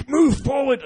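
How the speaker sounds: high, loud shout from the audience; yelled side coaching. Retold or imitated. Coaching rookies from the audience, shouting high and loud. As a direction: bark it at the rookies on stage. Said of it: "I was sitting there going".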